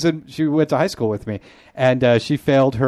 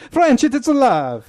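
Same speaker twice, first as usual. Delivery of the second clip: high, drawn-out hammed-up Italian accent; mock Italian accent; 11 semitones higher, 3 dB louder than usual. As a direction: proclaim it like a hammy Italian. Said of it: "That's my Italian accent speaking about French".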